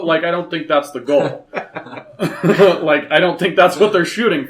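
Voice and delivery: laughing. Real, not acted. Laughing after his own bit.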